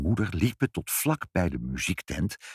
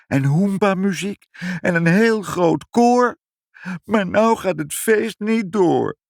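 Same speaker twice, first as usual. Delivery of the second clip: put-on crying child; 9 semitones higher, 4 dB louder than usual. A narrator's reading. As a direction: wail ever more miserably like a child. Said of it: "huilde Ibbeltje". (huilend, steeds harder en langgerekter jammerend)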